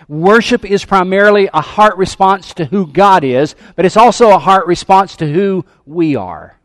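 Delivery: loud, driving preacher's voice; fervent sermon delivery; preaching at full force. Acted. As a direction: preach it out to the church. Preaching to congregation.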